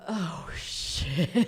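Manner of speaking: breathy "uh-oh" realisation; drawn-out hushed shock; horrified whisper. Retold or imitated. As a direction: whisper it in mock dread.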